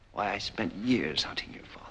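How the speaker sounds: hushed, intense whisper; bitter whisper. Acted. Avenger to the son of his enemy, intense whisper. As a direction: breathe it out with years of grudge.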